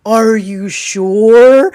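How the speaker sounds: drawn-out, loud, skeptical "are you sure"; a loud, slow, teasing challenge; mock doubtful challenge. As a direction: slowly question their certainty, teasing. Mocking the universe's doubt.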